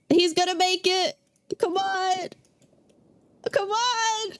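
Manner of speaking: high, urgent cheering on; root him on, thrilled; excited cheering. Real, not acted. Cheering the dog on.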